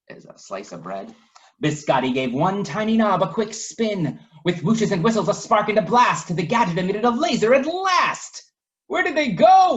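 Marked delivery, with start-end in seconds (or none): 1.6-8.5 climbing higher and louder narration; building excitement. A narrator's reading. Narrator builds to the laser blast, rising and louder. 8.9-9.8 high, loud worried shout; alarmed call. Acted. Waffle calls out through the smoke, high and loud. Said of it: "Waffle asked".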